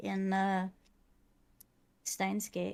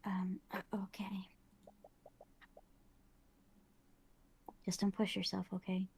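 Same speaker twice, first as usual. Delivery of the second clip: whispering; 8 dB quieter than usual. Acted. Whispered character lines.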